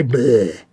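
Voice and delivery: low mock blurt of disgust; silly bleh. Speaker ending the spoof ad with a low bleh. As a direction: blow a raspberry-like bleh.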